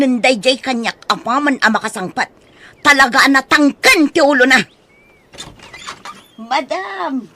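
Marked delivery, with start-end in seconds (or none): 2.8-4.6 loud, fed-up complaint; exasperated. Acted. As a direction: vent your anger at the child. Nana complains about stubborn child.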